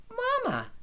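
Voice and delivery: put-on high, excited child's call; excited cry. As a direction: call out to mom, thrilled. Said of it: "Tibby cried".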